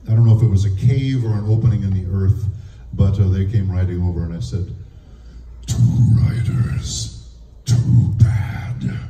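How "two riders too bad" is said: huge rumbling mountain, nearly voiceless rasp; a voice coming out of your heels; slow, scraping voice of living rock. Acted. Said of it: "I played a mountain".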